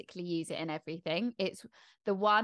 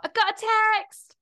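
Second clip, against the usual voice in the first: high excited shout "I got a text!"; 6 dB louder than usual. Retold or imitated. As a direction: squeal it like a reality star. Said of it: "they would have to shout".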